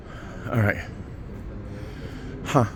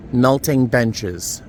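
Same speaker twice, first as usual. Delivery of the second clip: normal talking voice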